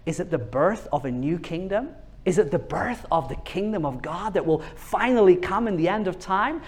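ordinary talk, nothing marked

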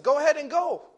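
put-on loud, high dare to a child; fed-up father. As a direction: act out a dad calling her bluff. Fed-up father dares his daughter.

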